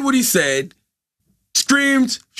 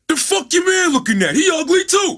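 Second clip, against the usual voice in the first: taunting shout; 10 semitones higher than usual. Retold or imitated. Retelling a stranger yelling insults at Charlamagne's friend. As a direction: shout it across the corner.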